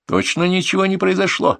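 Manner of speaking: suspicious wife checking up. A narrator's reading. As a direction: ask it with doubt, pitch held up. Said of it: "подозрительно спросила Маша".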